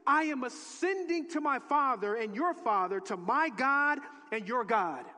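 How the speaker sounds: loud, deliberate scripture reading; emphatic scripture reading. Acted. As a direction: proclaim the verse. Reading Jesus' words.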